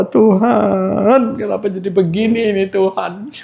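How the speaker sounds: high-pitched imitation of a weeping plea to God; mock tearful prayer. Jobless church members praying to God together, mock crying. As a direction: wail the prayer in mock despair. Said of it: "Nangis ya, nangis".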